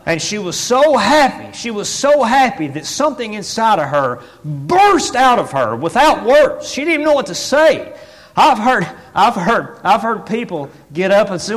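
loud, high, driving preacher delivery; impassioned preaching. Real, not acted. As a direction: preach it at full force. Preaching builds to full force.

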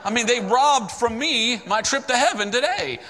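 furious at the robbers for cheating him of heaven; angry outburst. Retold or imitated. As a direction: spit out the scorn, slow and high. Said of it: "Then I started getting angry".

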